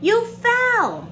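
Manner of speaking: high, loud grinning announcement; gloating brother. Acted. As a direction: announce it with a big grin.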